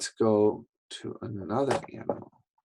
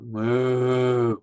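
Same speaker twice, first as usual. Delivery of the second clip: cow moo. Shown as a demonstration. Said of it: "They go".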